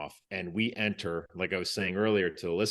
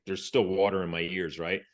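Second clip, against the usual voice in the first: ordinary delivery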